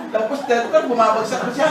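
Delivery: ordinary talk, nothing marked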